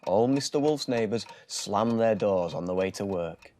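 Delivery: ordinary delivery, nothing marked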